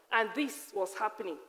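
ordinary delivery

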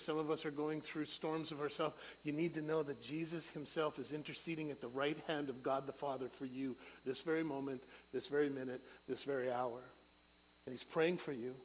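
ordinary talk

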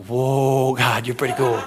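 low, drawn-out amazed aside; mock awe. Believer reacting when God does it, low mock awe. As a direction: lightly act out being impressed by God.

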